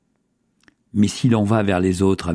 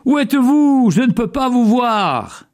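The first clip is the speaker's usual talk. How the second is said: cri d'appel; 13 semitones higher than usual. A narrator's reading. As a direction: shout across a valley to someone unseen. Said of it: "Il s'écria alors". (s'écrier très haut, comme au loin)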